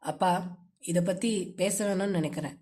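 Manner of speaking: low, curt reply from a son; low and terse. A narrator's reading. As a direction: let the words drop in short pieces. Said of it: "வார்த்தைகள் துண்டாக வந்து விழுந்தது".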